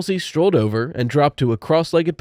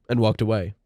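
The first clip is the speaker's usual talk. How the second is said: usual speaking voice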